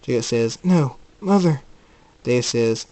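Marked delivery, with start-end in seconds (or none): 0.6-1.6 louder, higher-pitched plea; urgent protest. Acted. Jacob refusing to go back inside, urgent. Jacob insists on finding mother.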